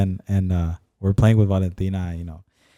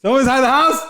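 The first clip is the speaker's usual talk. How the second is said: calling out. Retold or imitated. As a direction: call out to a stranger in the house.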